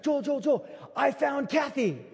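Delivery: high, slow excited calling of a name; imitating a friend's high excited blurt; excited news. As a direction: rush up bursting with the news.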